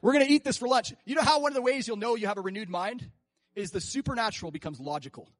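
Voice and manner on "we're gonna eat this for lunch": louder bold Caleb boast; bold confidence. Retold or imitated. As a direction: declare it like an easy win.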